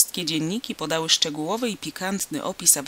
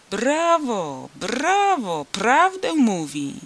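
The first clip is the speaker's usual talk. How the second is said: crowd cheer; 6 semitones higher, 4 dB louder, much slower than usual. A narrator's reading.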